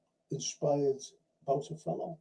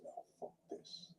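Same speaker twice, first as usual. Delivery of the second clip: muttered quote; 24 dB quieter than usual. Retold or imitated. Retelling him quitting the Air Force.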